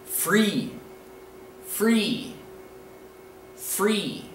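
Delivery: "free" with a long ee, falling; spaced, deliberate "free", vowel held; model pronunciation. Shown as a demonstration. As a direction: say "free" carefully for learners to copy. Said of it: "This word is pronounced".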